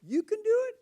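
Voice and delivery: high, loud incredulous question from the guys; doubting buddies. Retold or imitated. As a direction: ask like the guys can't believe it. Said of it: "And they said".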